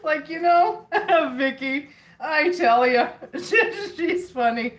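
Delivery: cracking up while naming Vicky; laughing. Real, not acted. Reading Vicky's chat comment, laughing loud.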